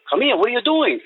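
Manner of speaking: retold dog call, not shouted. Retold calling to dog again.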